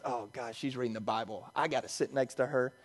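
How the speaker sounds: low, hushed grumble to himself; muttered aside. Retold or imitated. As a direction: imitate a disgruntled passenger under his breath. Grumbling to himself about seatmate.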